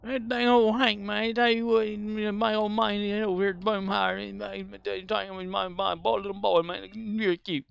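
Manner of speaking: put-on gushing old lady mumble; Boomhauer's mother. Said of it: "Boomhauer's mother speaks the same way".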